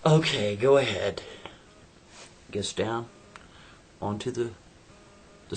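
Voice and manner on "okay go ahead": challenging okay. Acted. She challenges him.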